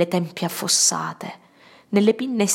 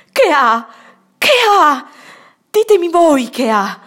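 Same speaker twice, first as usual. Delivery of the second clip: frantic cry; 9 semitones higher, 9 dB louder than usual. Acted. Mother clutching her unresponsive son.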